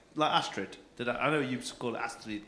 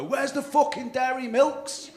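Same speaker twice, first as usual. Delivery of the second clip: a touch of a loud Brit abroad, higher and louder; mock English tourist; 13 semitones higher, 7 dB louder than usual. Mocking English tourists demanding chocolate abroad, lightly hammed loud. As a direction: lightly ham up the brash tourist. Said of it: "and we just go".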